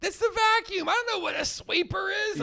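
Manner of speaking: very high mock yell; exasperated daughter. Daughter answering her yelling dad, high-pitched protest.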